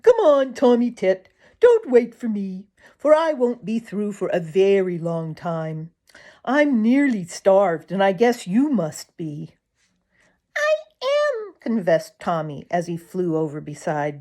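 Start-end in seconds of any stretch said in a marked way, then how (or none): 0.0-9.5 higher, louder bird voice, hearty and hungry; a starving woodpecker waving a friend in. Acted. Drummer the woodpecker talks. 10.6-11.5 very high little bird voice, eager; a tiny bird spotting food; chickadee voice. Acted. Tommy Tit the chickadee speaks.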